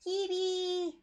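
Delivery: high, squeaky cartoon voice; excited cutesy mascot squeal; chippy voice. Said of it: "I can do chippy".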